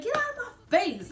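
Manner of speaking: put-on high, loud kid voice; defiant kid. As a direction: snap at the adults like a child.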